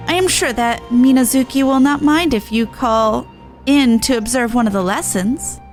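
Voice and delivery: ordinary talk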